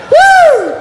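high, loud "woo!"; a real burst of excitement in one whoop; excited whoop. Real, not acted. Preacher too excited to finish, excited whoop. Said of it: "I get so excited".

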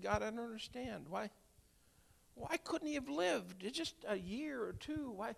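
high, soft, pleading lament to God; retold anguished plea. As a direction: plead quietly upward, asking God why.

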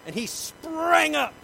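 very high, loud, stretched SPRANG; explosive energy. A narrator's reading. Preacher showing Bartimaeus leaping up, explosive. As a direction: launch SPRANG upward in a long shout. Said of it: "You can feel the energy".

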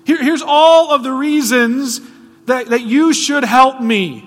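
loud, high and drawn-out pleading; preacher voicing the woman arguing she's worthy, loud and high; pleading self-justification. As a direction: beg loudly, listing your merits. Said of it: "She doesn't convince Jesus that".

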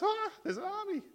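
high-pitched mock-frightened squeal; panicked fear. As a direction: act out a terrified servant, voice shooting up. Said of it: "the servant is paralyzed by its fear".

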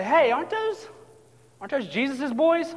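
high, rising questions; curious onlookers. Acted. Folks on the shoreline spot the disciples, high curious questioning. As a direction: wonder aloud as if spotting someone known. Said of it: "the folks on the shoreline say".

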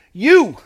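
"You" punched high and loud; emphatic. Retold or imitated. Preacher voicing Paul's address to the church, emphatic. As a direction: hit "You" hard as one emphatic shout. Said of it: "in a very emphatic way".

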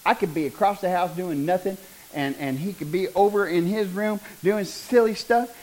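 ordinary delivery

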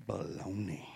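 low, voiceless aside; hushed scornful verdict; whispered dismissal. Acted. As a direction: whisper it under the breath.

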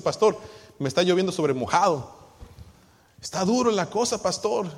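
a mock desperate churchgoer; whining congregant. Congregant complaining to pastor.

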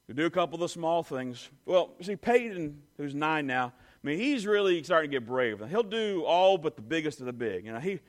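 ordinary talk, nothing marked

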